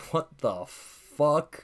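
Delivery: very slow, drawn-out mock shock; retold disbelief. Streamer retells reading the vampire premise, aghast in slow motion. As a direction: stretch each word in disbelief.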